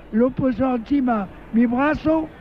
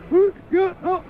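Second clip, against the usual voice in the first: high mock sobs of a little boy; halting imitation of a child crying; crying small child; 6 semitones higher, 3 dB quieter, slower than usual. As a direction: imitate a child too tearful to explain. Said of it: "entonces estaba llorando".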